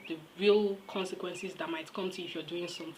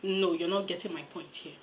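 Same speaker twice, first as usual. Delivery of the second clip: ordinary talk, nothing marked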